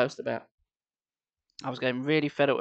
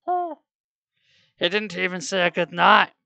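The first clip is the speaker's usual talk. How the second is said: teasing woman; 4 semitones higher, 4 dB louder than usual. Acted. Nyla's voice.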